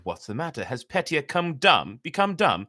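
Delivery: lightly voiced sly fox, rising; mild mock concern. The fox teases the silent cock, a touch of mock concern. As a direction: tease him with feigned worry. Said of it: "Fox said".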